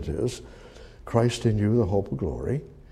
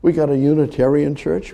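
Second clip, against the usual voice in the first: ordinary talk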